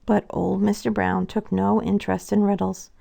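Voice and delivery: ordinary delivery, nothing marked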